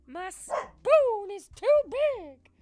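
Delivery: very high, very loud slow shriek; shrieking meme voice. Pretend. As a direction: scream the meme line. Repeats the meme line much louder.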